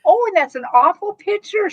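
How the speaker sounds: high, loud giddy squeal; excited squawk. Retold or imitated. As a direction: squeal it out, delighted and teasing.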